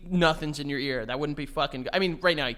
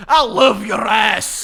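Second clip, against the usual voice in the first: slow, high, blaring Scottish come-on; shouted Scottish accent; 5 semitones higher, 11 dB louder, much slower than usual. Acted. Showing Scottish can't be calm or sexy, shouted Scottish accent. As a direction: bellow it in broad Scots, drawn out. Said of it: "can't speak with a Scottish accent".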